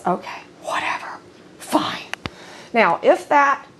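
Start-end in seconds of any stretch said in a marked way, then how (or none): 0.1-2.0 whispering a sulky dog's reply; whispered dog voice. Pretend. Owner voicing her submissive dog's grudging reply, whispered. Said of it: "He says".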